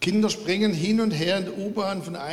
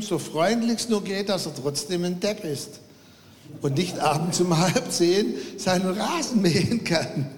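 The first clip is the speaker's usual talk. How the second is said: usual speaking voice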